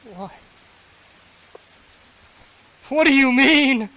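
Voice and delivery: high, loud grief-stricken accusation; anguished outburst. Acted. Father accusing Asante of failing his daughter, in anguish. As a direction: hurl it at him in anguish.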